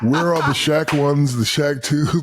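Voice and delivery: high, laughing, exasperated mock outrage. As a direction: act out jealous disbelief with a laugh. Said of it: "I'm like".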